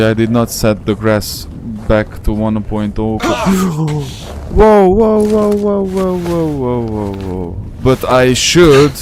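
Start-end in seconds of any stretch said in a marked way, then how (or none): 3.2-4.5 sharp surprised intake of breath; startled gasp. Real, not acted. Startled by an enemy in the game, a sharp gasp. 4.5-7.7 panic, then settle as the danger passes; alarmed whoas. Real, not acted. Startled gamer to his stream, high whoas fading down.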